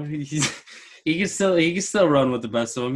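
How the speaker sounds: normal talking voice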